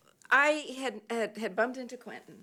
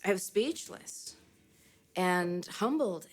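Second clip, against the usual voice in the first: ordinary talk, nothing marked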